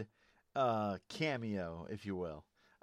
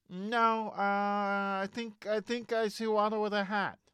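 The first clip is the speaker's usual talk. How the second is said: George Lucas impression; 8 semitones higher than usual. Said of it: "That, that's my George Lucas".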